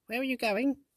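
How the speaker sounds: very high, small sprite voice; tiny fairy voice. Acted. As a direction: squeak it out like a little fairy. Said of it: "the voice asked".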